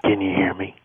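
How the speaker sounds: whispery, breathy phone caller; half-whispered and full of air. Acted. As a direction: ask it on a loose, airy breath. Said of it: "Why do you sound so breathy?".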